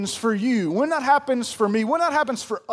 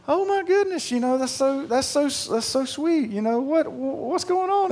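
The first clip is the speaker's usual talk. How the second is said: touched inner voice; 5 semitones higher than usual. Retold or imitated. Dad's touched inner thoughts.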